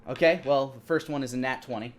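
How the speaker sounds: ordinary delivery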